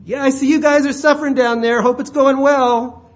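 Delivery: high, loud, breezy call down; detached God. Acted. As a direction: call out a careless greeting from far above. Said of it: "It's not a detached God who waves from heaven".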